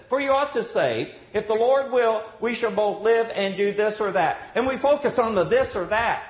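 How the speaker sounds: loud, high preaching voice, building; rising sermon fervor; fervent preaching. Acted. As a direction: preach it out with fire. Preaching at full force.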